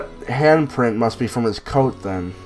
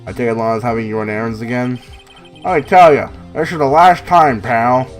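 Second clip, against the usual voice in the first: gruff detective voice. Acted. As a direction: bark it like a tough cop. Voicing the detective.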